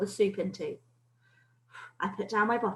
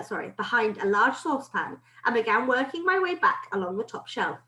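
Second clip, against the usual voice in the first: ordinary delivery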